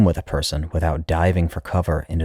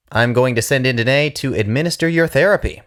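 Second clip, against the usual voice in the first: higher, brighter doctor voice; pitched-up brisk announcement; young doctor; 8 semitones higher than usual. Acted.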